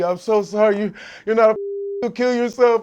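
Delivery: high, loud tearful apology with a laugh breaking in; retold crying apology. Imitating his crying coach apologizing in the hospital room, tearful. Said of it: "my coach crying".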